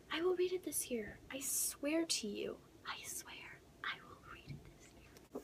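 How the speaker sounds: unvoiced, fading whisper of a promise; hushed vow. Real, not acted. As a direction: swear it softly to the viewers.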